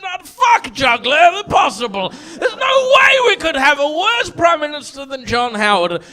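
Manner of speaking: hammed-up furious shouting; mock angry rant. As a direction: rant like an angry student protester. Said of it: "we were like".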